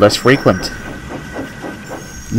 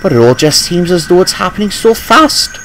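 higher, wistful engine voice; a fretful old engine, pitched up; worried engine voice; 8 semitones higher than usual. A narrator's reading. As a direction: Rheneas frets that it all happens too fast. Rheneas worried.

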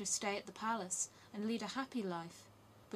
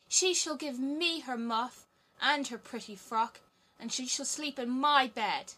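put-on spoiled robber girl; 5 semitones higher, 7 dB louder than usual. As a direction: demand it like a spoiled brat. Robber girl demands Gerda's things.

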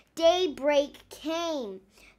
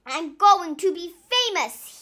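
louder, gleeful whoop; 4 semitones higher, 8 dB louder than usual. Acted. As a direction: whoop with delight. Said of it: "he whooped".

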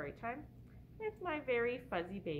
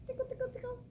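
playful tickle talk; 9 semitones higher, 4 dB quieter, much faster than usual. Pretend. Tickling the baby.

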